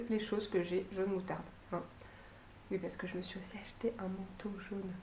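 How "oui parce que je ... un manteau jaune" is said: confiding whisper, as a little secret; whispered aside. Real, not acted. Vlogger whispering her yellow-coat confession to viewers. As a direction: drop to a whisper to admit it.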